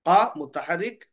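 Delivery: ordinary talk, nothing marked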